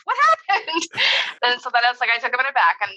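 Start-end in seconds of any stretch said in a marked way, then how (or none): 0.0-1.5 high, startled "What are you doing? what happened?"; alarmed parent reaction. Retold or imitated. Mom finding her son muddy, alarmed. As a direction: sound caught off guard and upset. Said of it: "I reacted in this way".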